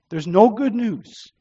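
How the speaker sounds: soft, emotional, composure slipping; getting emotional. Real, not acted. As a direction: voice dropping as real emotion takes over. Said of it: "I'm getting so emotional".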